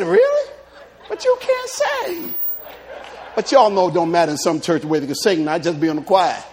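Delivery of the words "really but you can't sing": very high, slow, teasing; mock disbelief. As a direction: ring out the disbelief. Father teasing son.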